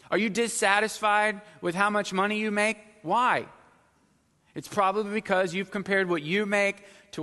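loud, high sermon climax; full-force preaching. Acted. Pastor pressing the congregation about comparison, loud high preaching. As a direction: preach it out to the back pew.

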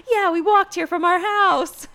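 high, loud, slow gushing; hammed-up thrilled parent voice; mock excitement. Vlogger mimicking parents who live down the road, mock excited.

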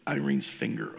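ordinary delivery

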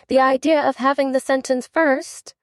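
high-pitched, scandalized objection; indignant Alice, voice pitched well up; loud protest. A narrator's reading. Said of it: "said Alice loudly".

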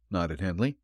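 plain reading voice

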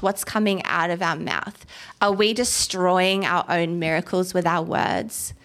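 ordinary talk

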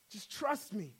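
soft reassurance. Acted. God as trainer reassuring the struggling believer, hushed. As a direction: drop right down to a quiet assurance.

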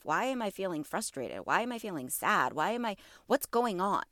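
ordinary talk

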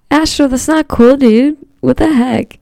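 loud, high scolding of a pet; scolding shout. Real, not acted. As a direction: yell at the cat in disbelief. Scolding cat after it peed.

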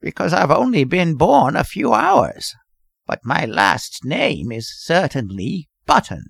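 put-on cracked old man's whine; creaky, wavering old-timer; querulous whine. A narrator's reading. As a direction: explain peevishly in an aged voice. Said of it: "replied the querulous whine".

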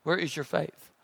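hushed soul-searching repeat; soft echo. Shown as a demonstration. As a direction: let it sink in quietly. Repeated softer.

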